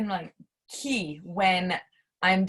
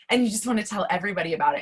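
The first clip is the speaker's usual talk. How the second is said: normal talking voice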